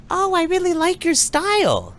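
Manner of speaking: high-pitched sweet old lady impression; little old lady. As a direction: coo a compliment in a high, put-on old-lady voice. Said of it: "This little old lady".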